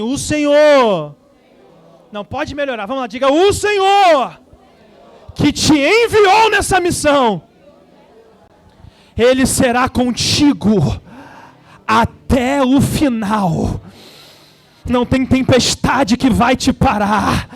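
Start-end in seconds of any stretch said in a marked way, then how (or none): 0.0-1.2 slow, loud, commanding prophet. Shown as a demonstration. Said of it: "voz de profeta". 3.3-13.8 loud, slow prophetic shout. Shown as a demonstration. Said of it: "voz de profeta".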